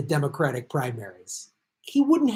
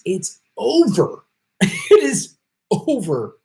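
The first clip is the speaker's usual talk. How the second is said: higher, slow, laughing "it is over"; gleeful gloating; 7 semitones higher, slower than usual. Real, not acted. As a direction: savour the win with a laugh, drawn out. Gloating over Texas win.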